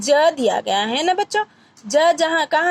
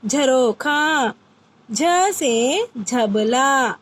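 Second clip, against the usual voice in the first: slow demo; much slower than usual. Shown as a demonstration. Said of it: "teacher drilling words".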